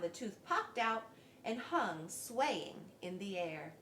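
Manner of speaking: ordinary delivery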